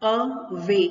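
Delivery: "अवे" broken as अ, pause, वे; split into syllables. Shown as a demonstration. Teacher showing two syllables in अवे, split apart. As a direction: separate अ from वे in "अवे". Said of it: "जो दो syllable है".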